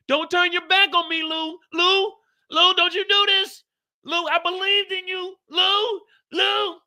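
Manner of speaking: pleading manager. Retold or imitated. Lo begging Lou to stay.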